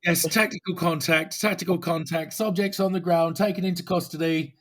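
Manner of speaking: hammed-up officer reporting "tactical contact" over the radio; British cop on the radio. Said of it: "I think they call it in the U K".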